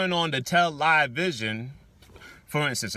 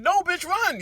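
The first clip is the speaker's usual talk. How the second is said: much higher shouted warning; yelling at the screen; 3 dB louder, slower than usual. Retold or imitated. Viewer watching a scary movie, yelling at the girl to run. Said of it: "You're like".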